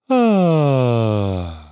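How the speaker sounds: long falling open sigh, letting go; a loud easy sigh sliding down; relaxed sigh. Shown as a demonstration. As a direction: breathe out a loose easy sigh. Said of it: "You just sigh like this".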